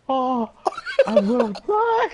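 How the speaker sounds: high, loud, slow laughing scream; hammed-up panic shout; mock terror. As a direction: shriek it like you're doomed. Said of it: "I go".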